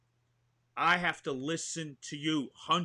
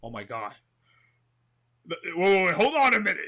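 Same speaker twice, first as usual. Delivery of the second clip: loud, high stunned shout of joy; whoa, hold on, yelling with delight; excited disbelief; 4 semitones higher, 11 dB louder, faster than usual. Acted. Actor hearing De Niro picked him, shouting excitedly. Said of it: "But I'm so excited".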